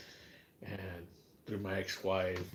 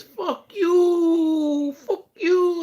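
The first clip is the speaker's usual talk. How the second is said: shrieked, much higher laughing yell; mock shout; 17 dB louder, much slower than usual. Voicing the other guy's reply as a laughing shriek. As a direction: scream it high, cracking with laughter. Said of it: "He says".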